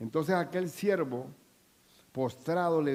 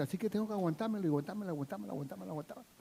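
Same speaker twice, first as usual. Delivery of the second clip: mock grumbling; 3 semitones higher, 8 dB quieter, much faster than usual. Mock grumbling about enduring.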